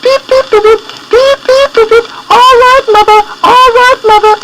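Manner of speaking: tiny high peeping chicks; chick voices. A narrator's reading. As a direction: squeak it like little chicks. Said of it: "the little chickens said".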